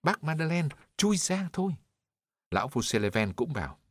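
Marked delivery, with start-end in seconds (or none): 0.0-1.7 much higher, urgent shout from the crowd. A narrator's reading. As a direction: call out urgently to the man under the cart. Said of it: "người ta kêu ầm lên". (hét to gọi, giọng vút cao)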